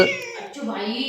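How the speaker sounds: soothing. Real, not acted. Soothing the upset dog.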